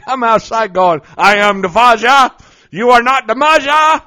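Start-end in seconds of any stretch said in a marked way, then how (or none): 1.2-4.0 booming mock proclamation, louder and higher; playful yelling. Real, not acted. Dad yelling in the garage to entertain himself, booming. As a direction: shout it out like a goofy dad hero. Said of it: "Are you in the garage yelling?".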